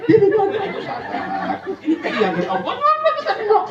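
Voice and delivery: shrieking mourners consoling; high comic wailing. Acted. Mourners shriek comfort at the widow. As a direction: ham up the crowd at the funeral.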